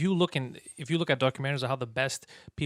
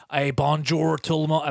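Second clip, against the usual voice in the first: French with a heavy English accent, a bit louder; 5 dB louder, slower than usual. Shown as a demonstration. Speaker mocking English-accented French, heavy English accent. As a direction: put on a thick Anglophone accent in French. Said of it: "the English heavy one".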